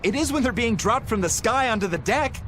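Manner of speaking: worried crewman imagining a swordfish dropping on someone, pitch climbing in panic; rising panic. Acted. Panicking about falling swordfish.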